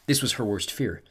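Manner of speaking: ordinary delivery, nothing marked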